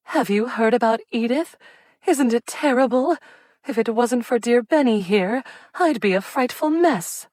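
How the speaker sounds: high, fluttering distressed voice; an anxious aunt wringing her hands; fretful society lady. A narrator's reading. Cordelia frets about Edith.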